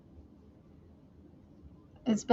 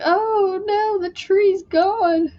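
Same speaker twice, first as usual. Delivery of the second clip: mock dismay; 8 semitones higher, much slower than usual. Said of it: "I was like".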